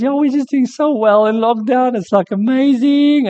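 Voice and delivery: chirpy high voice putting on a show; Charmaine. Retold or imitated. Comedian wife introducing her husband, high and bubbly. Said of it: "And she goes".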